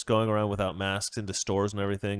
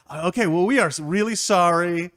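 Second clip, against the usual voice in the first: sheepish apology; 6 semitones higher, 6 dB louder than usual. Acted. Couple apologizing.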